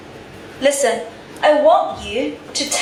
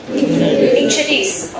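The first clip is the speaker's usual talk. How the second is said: "Introduce" slowly, in a lower pitch; slow model word; 7 semitones lower, slower than usual. Shown as a demonstration. Teacher leads the class in repeating a new word, slowly enunciated. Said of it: "Together, introduce".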